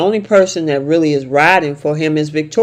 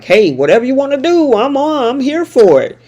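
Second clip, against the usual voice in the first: eager yes man; 9 semitones higher than usual. Retold or imitated. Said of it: "Nate is like".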